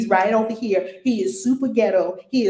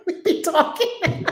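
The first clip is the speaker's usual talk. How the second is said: airy giggling laugh around words; breathy laughter; 11 semitones higher, slower than usual. Real, not acted. Host laughing at her own slang with viewers.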